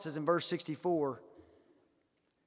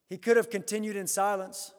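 ordinary delivery

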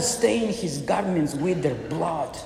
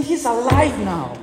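joyful cry; 7 semitones higher, 5 dB louder than usual. Acted. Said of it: "They were saying".

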